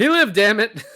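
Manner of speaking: sudden loud outcry, pitched high; exclaiming loudly. Real, not acted. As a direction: blurt it out with a burst of force. Joking outburst about the ending.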